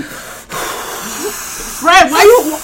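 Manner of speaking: breathing loudly into the mic; heavy breaths on the mic; heavy nose breathing. Real, not acted. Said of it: "Breathing into the mic".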